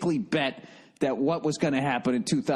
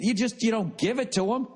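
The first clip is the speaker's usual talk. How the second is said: ordinary talk, nothing marked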